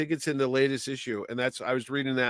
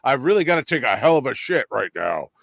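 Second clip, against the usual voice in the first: mock the hero voice on a crude line; mock Superman voice; 2 semitones lower, 4 dB louder than usual. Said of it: "They're like".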